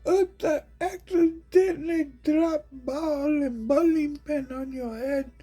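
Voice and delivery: squeaky, very high and loud mock voice; mocking falsetto. Mocking onlookers of the clown, squeaky high falsetto. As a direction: squeal it like a cartoon fool. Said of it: "and they be like".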